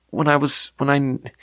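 ordinary talk, nothing marked

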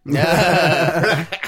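big burst of laughter; loud laugh. Real, not acted. Co-host reacts to jab at producers, loud laugh. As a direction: laugh out loud, long and strong.

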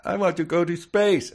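put-on high, loud child's voice; whiny little kid. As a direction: pipe up like an excited small boy. Voicing little Tommy pleading.

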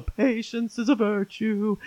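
lightly mocked mother, slow and drawn out; a hint of a preachy mother, slowed right down; mocking mom's saying. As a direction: tease mom by slowly stretching out her old line. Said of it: "We'd make fun of the way she said it".

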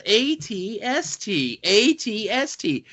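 "A T S T" slow and rising; sing-song AT-ST. Shown as a demonstration. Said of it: "the last time I do it".

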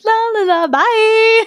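high, singsong la la bye; carefree tree. Retold or imitated. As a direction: skip away with a merry singsong. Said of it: "He's like".

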